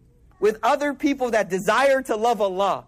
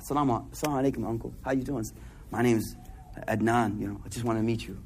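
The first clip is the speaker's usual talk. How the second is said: shy greeting; 11 semitones lower, 7 dB quieter than usual. Acted. Acting out greeting an uncle.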